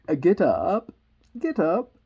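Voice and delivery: loud, piercing royal command; shrill, loud Queen. Acted. Queen ordering the gardeners up, shrill and loud. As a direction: order them up sharply and loudly. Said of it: "in a shrill, loud voice".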